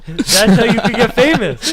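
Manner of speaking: laughing through the words; grinning, laughing as you speak. Real, not acted. Joking, laughing while talking.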